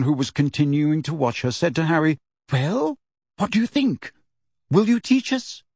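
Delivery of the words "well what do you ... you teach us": Hermione voice. A narrator's reading. Hermione pleading Harry.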